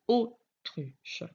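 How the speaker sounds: "autruche" slowly split into separate syllables; découpé en syllabes. Shown as a demonstration. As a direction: sound out "autruche" piece by piece, with pauses. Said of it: "J'entends le O au début du mot". (« autruche » lentement, syllabe par syllabe)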